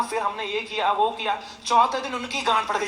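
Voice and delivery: ordinary delivery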